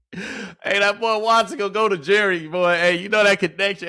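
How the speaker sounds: loud, high hype; hyped shout. Real, not acted. Streamer hyped about Watson throwing to Jerry, loud and high. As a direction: shout it out, excited.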